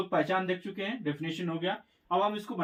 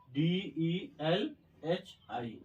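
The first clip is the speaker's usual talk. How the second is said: letters spelled out very slowly one by one; slow spelling; 4 dB quieter, much slower than usual. Shown as a demonstration. Teacher spells Delhi for the class, slowly and deliberately. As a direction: dictate each letter so students can write.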